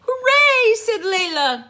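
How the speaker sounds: very high, loud hooray; joyful cheer. Acted. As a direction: cheer like a little girl. Princess celebrates victory.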